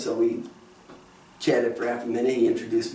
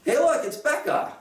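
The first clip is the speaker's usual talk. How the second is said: high-pitched imitation of a sudden yell; excited mock call-out, pitched well up; 13 semitones higher than usual. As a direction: call out high without much volume, as if spotting someone. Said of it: "heard him suddenly yell".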